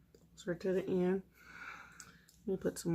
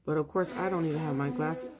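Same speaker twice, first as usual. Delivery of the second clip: ordinary talk, nothing marked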